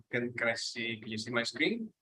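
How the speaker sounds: ordinary delivery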